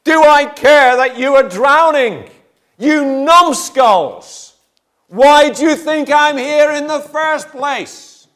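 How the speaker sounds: high, loud, slow indignant rebuke; exasperated Jesus. Retold or imitated. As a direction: stretch each word out in outraged disbelief. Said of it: "to ask me".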